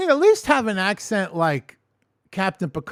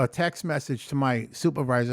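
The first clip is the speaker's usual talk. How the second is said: ordinary delivery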